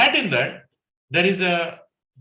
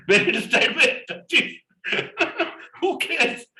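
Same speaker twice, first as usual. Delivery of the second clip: laughing; 12 semitones higher than usual. Real, not acted. Laughing retelling kerchief lock.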